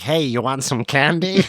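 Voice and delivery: slow, put-on American accent; American kid. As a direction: play a cartoonish American kid offering something. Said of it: "Why American?".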